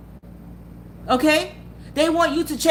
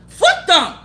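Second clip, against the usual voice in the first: louder, higher, spat out in scorn; loud dismissive outburst; 6 semitones higher, 6 dB louder than usual. Real, not acted. To viewers crying over exes, loud scornful outburst. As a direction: blow them off with a loud curse. Heated rant about exes.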